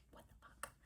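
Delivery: breathy whispered what the fuck; whispered curse. Retold or imitated. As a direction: mouth the curse almost silently. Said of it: "'cause I'm like".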